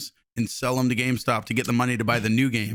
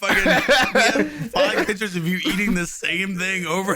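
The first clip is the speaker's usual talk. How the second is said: laughing; 7 semitones higher, 3 dB louder than usual. Pretend. Mock IRS agent, laughing.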